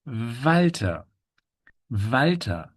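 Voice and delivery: "Walter" as VAL-tuh, with a buzzing v; German W. Shown as a demonstration. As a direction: start "Walter" on a long voiced v. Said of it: "this W in German".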